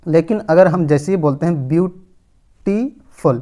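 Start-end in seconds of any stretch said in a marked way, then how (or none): 1.7-3.4 ब्यूटीफुल in three separate beats, ब्यू, टी, फुल; syllable by syllable. Shown as a demonstration. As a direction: break ब्यूटीफुल slowly into ब्यू... टी... फुल, pausing between each. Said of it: "इसमें तीन syllables है total". (ब्यूटीफुल को तीन टुकड़ों में रुक-रुक कर: ब्यू, टी, फुल)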